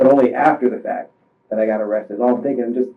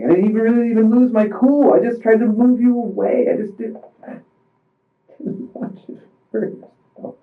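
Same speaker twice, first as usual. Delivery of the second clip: high, tearful voice that drops to a broken whisper; fighting back sobs as it slips out; on the verge of tears; 8 semitones higher than usual. Real, not acted. Telling the investigator it wasn't on purpose, tearful and breaking.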